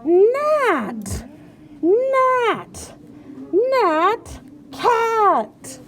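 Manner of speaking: high, loud scolding of the cat; pretend-angry shout, stretched high on every word; mad. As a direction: yell each word crossly, one at a time. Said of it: "let's read it mad".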